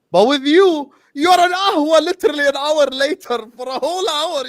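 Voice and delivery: high-pitched, exasperated protest; indignant complaint. Real, not acted. Panelist blaming a caller for being late, high indignant voice. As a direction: pitch it up in outraged accusation.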